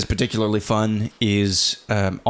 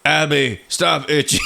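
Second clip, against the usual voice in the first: higher mock daddy telling-off; mimicking dad's voice; 5 semitones higher, 3 dB louder, slower than usual. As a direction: kid imitating dad's stern tone, pitched up. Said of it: "He totally tried to mimic me".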